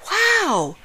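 high, awestruck exclamation; amazed. A narrator's reading. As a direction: let out a high wow of wonder. Frog sees the tall tree.

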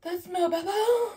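high-pitched amazed reveal; hammed-up wide-eyed surprise; mock astonished recognition. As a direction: squeal it in exaggerated recognition. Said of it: "until you're like".

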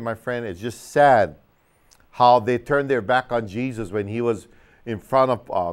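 usual speaking voice

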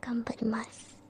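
whispered, barely voiced; tiny voice. Real, not acted. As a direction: say it almost inaudibly. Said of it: "声ちっさ".

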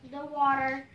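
usual speaking voice

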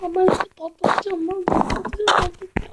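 high, crying threat; half-sobbing in frustration; frustrated whimper. Real, not acted. Gamer about to burst, whimpering high.

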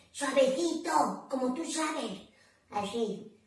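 soft, slow contented cooing; soothed and tender. Pretend. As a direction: melt quietly under a gentle stroke. Enjoying a gentle stroke.

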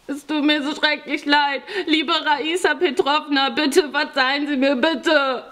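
put-on high-pitched, whimpering boy; tearful pleading. A narrator's reading. Boy begs Raisa to forgive him, tearful pleading. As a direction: beg desperately for forgiveness. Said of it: "flehte Mitja die beiden an".

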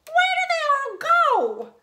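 high, loud witch shout. A narrator's reading. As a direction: yell it like a frantic witch. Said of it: "Gretch shouted to a duck".

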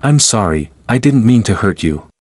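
ordinary talk

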